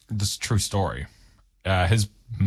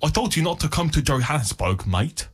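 South African accent; 6 semitones higher than usual. Shown as a demonstration. Man warns mate off Johannesburg, in a South African accent. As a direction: put on a rough attempt at South African. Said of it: "that's my, uh, South African accent".

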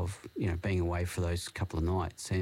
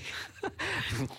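high giggle; 3 dB quieter than usual. Real, not acted. High laugh burst.

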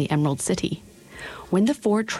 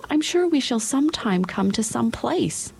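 a hint of a hopeful young girl; light girlish voice, higher; lightly voiced young girl; 6 semitones higher than usual. Dorothy character voice.

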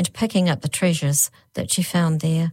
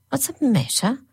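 higher, rising puzzled question; first nurse, puzzled; 8 semitones higher than usual. A narrator's reading. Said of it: "said the first woman".

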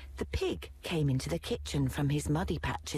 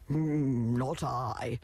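low grunting pig; lightly grunted pig reply; 3 semitones lower, much slower than usual. A narrator's reading. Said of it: "grunted the pig".

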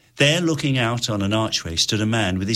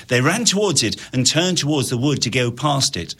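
plain reading voice

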